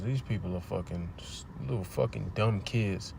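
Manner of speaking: quiet grumble under the breath; hushed aside. Real, not acted. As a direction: drop the voice while watching trouble outside. Cops arrive, hushed aside.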